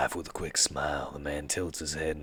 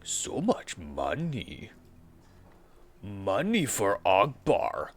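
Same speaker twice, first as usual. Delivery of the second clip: slow greedy voice; 5 semitones higher, 4 dB louder, slower than usual. Acted. Troll savoring money.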